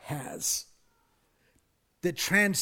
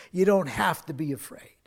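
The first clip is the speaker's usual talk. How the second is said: ordinary talk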